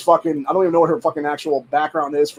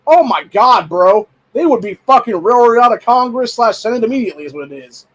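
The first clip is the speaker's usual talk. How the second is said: higher and louder, outraged; indignant outburst; 6 semitones higher, 6 dB louder than usual. Real, not acted. Ranting to viewers about Republicans, indignant outburst. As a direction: let the outrage spill over.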